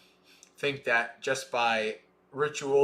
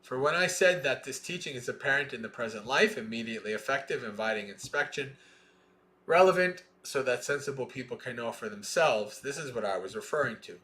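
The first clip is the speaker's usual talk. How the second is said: measured, deliberate scripture reading; sutta recitation; 4 dB louder than usual. Acted. Teacher reading the sutta to students, measured recitation. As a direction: recite each line of the chain steadily.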